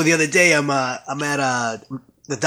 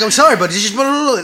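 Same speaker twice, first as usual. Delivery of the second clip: higher mock babble, apologizing; fast-forward mode; 5 semitones higher, 3 dB louder than usual. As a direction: imitate yourself rattling on into blahs. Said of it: "I'm on fast forward mode".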